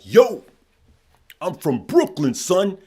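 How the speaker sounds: loud, high mock Brooklyn "Yo... son"; hammed-up New York street voice yelled out the car window; Brooklyn accent. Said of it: "I'm not, I'm from Chicago".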